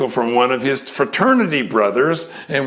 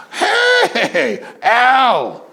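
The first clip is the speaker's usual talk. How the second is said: much higher, laughing greeting; light up at an old friend; delighted recognition; 9 semitones higher than usual. Retold or imitated. Greeting an old college friend, laughing delight.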